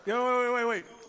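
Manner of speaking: usual speaking voice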